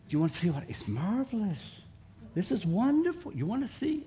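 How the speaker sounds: high, sweet coaxing voice; coaxing a pet. Shown as a demonstration. Preacher luring the pet closer, high and sweet. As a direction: sell it like a treasure to a shy pet. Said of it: "I have to make a noise".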